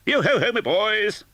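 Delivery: put-on rich, jolly old master's hail, pitched high; a hearty boss calling his lads; jovial voice. Fezziwig calls out.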